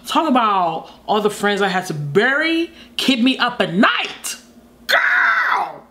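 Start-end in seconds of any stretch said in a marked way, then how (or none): 4.9-5.8 shrieked exclamation; high shout. Real, not acted. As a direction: squeal it out high. Exclaiming at song.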